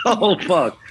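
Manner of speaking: starstruck shock. Retold or imitated. Photographer realizing he is talking to [name], mildly shocked. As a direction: replay a moment of stunned realization.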